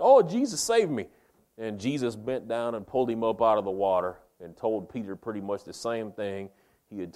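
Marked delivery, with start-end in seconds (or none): 0.0-1.1 high, loud cry for rescue; scared holler. Retold or imitated. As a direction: holler out in mock panic, pitch leaping up. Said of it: "got all scared and he hollered out".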